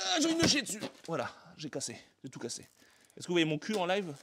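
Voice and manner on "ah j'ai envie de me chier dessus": high cry of dismay; anguished cry. Real, not acted. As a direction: wail it out in panic. Statue breaks, cries out.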